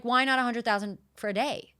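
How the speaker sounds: ordinary talk, nothing marked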